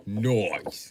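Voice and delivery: "Noise" as a British "noice", falling; mock British accent. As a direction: drop it like a cheeky Brit. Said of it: "The accent is perfection".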